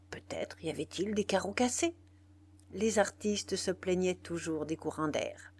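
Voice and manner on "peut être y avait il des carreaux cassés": plain reading voice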